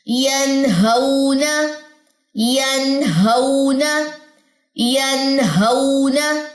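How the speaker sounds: यनहौना very slow, each syllable held; measured, drawn-out यनहौना on sustained notes; recitation practice. Shown as a demonstration. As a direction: chant यनहौना evenly, stretching N and the glide. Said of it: "practice बड़े मजे की हो जाए".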